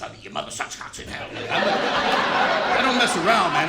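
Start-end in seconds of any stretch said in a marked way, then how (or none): 0.2-1.3 low, breathless demon rasp; exorcist demon voice. Retold or imitated. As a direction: whisper it like a possessed child. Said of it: "I'm like the exorcist".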